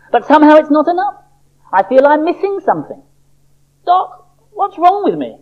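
high, restless little-seed voice; a discontented seed pleading for help; Sammy the seed. Acted. Sammy complains to the doctor.

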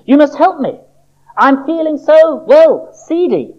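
high little-seed voice, slowing on the pun; Sammy the seed voice. Acted. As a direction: drag out seedy like a sorry patient. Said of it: "he said as he entered the surgery".

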